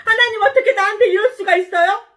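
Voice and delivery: high, loud, fast protest; acted furious complaint; angry outburst. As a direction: shout in outrage at being wronged. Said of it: "이러고 화를 냈어요".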